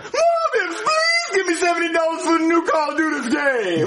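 high pleading shout; whiny yelling kid. Acted. Dougie yelling for the game.

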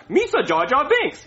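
high cartoon alien impression; bouncy, high-pitched impression; Jar Jar Binks. Shown as a demonstration. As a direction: show off a goofy character voice. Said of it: "I can imitate any character".

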